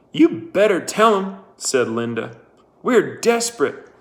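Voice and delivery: high, urgent pleading woman; desperate pleading. Acted. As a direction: beg her to tell. Linda pleading.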